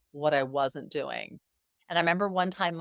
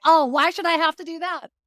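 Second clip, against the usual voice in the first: indignant whine; 11 semitones higher, 8 dB louder than usual. Retold or imitated. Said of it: "and we're like".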